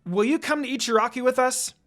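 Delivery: loud, high, eager call; yelled. A narrator's reading. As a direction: shout the invitation across to him. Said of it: "and yelled".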